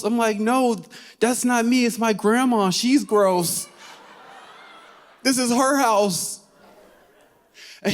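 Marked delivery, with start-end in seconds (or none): 0.4-6.4 high, pleading self-defence; retold frantic protest. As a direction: push the blame away in a raised pitch.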